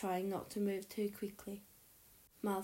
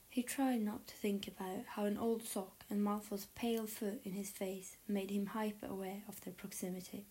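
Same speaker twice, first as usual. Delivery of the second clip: plain reading voice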